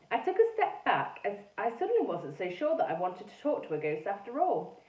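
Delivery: ordinary delivery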